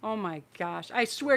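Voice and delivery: usual speaking voice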